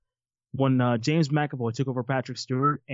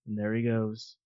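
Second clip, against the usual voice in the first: normal talking voice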